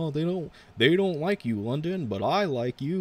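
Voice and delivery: high, loud, sing-song mock promo; mocking promo impression. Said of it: "roll my eyes at that".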